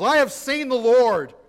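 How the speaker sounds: high, loud joyful proclamation; exultant announcement. Acted. Mary Magdalene announces to the disciples, an exultant shout. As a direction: proclaim it loudly to the whole room. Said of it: "she announced to the disciples".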